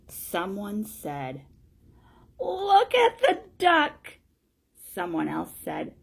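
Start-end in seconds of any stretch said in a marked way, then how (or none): 2.4-4.2 high, loud, drawn-out crowd cry; jeering onlooker. Acted. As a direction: call it out slowly in a high put-on voice. Onlooker mocking the duck.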